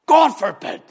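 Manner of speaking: forceful high shout; loud, emphatic. Acted. Preacher showing how Paul's verse reads, loud and expressive. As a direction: burst out with an emphatic refusal. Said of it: "it's loud, it's expressive".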